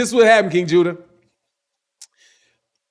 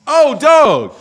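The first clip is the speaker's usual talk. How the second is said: laughing out loud, higher and louder; 8 semitones higher, 6 dB louder than usual. Real, not acted. Streamer reading a super chat, real laugh. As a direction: burst out laughing at the comment.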